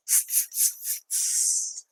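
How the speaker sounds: whispered tss of teasing monkeys; monkey tsk. Acted. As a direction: hiss tss back like cheeky monkeys. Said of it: "the monkeys ... said".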